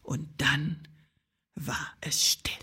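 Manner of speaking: very soft, near-whispered storytelling; hushed narration. A narrator's reading. Narrator as silence falls after the rage, hushed. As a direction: let it drop to a whisper with pauses.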